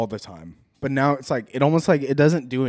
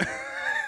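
high wheezing laugh; 7 dB quieter than usual. Real, not acted.

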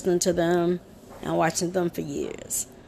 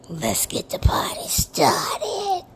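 party voice; 13 semitones higher, 3 dB louder, slower than usual. Acted. Party call after statement.

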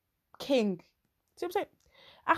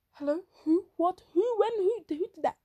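higher, rapid-fire bewildered questions; acted disbelief, pitched up; mock outrage; 7 semitones higher than usual. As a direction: fire off short, incredulous questions. Acts shocked sweet owner interrogating.

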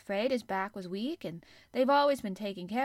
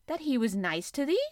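wide-eyed cry; 6 semitones higher, 6 dB louder than usual. A narrator's reading. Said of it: "cried Martha with, uh, wide open eyes".

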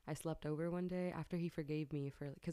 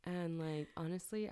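usual speaking voice

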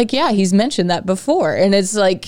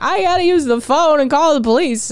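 mock panic; 8 semitones higher than usual. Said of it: "he's like".

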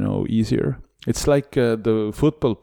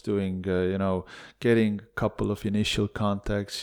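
ordinary delivery

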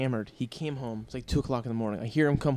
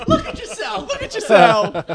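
high, then slower and louder through laughter; laughing taunt; 8 dB louder, slower than usual. Retold or imitated. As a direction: point it out while laughing hard. Showing drunk his face, laughing.

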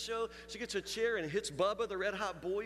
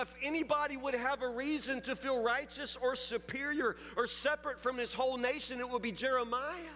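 higher, insistent preaching voice, building conviction; rising sermon; 4 semitones higher than usual. Acted. Pastor urging the congregation on Jeremiah's love, preaching. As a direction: preach it to the congregation, pressing the point home.

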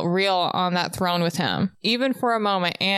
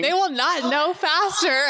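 high-pitched playful retort; mock indignation; 9 semitones higher than usual. Reader rebutting co-host's plea to hurry, mock-indignant. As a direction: protest in a raised, teasing voice.

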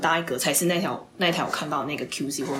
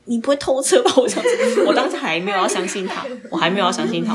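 high, louder, through laughter; laughing at her own suspicion; 4 semitones higher, 5 dB louder than usual. Retold or imitated. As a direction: giggle through the accusation. Laughing while retelling suspicion.

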